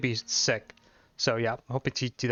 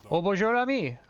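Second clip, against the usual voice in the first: put-on French accent; 6 semitones higher than usual. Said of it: "a stupid French accent".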